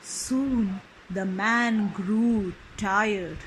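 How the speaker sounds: ordinary delivery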